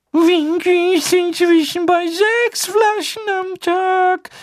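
hohe Elfenstimme, vertraulich langsam; wispernder Hauself. A narrator's reading. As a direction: raune es wie ein besorgter Elf. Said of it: "wisperte Dobby". (hoher Elf, vertraulich)

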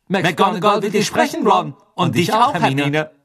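loud, high-pitched announcement; laut verkündend. A narrator's reading. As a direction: call it out to them, high and loud. Die Zwillinge rufen ihre Botschaft. (laut und hoch gerufen)

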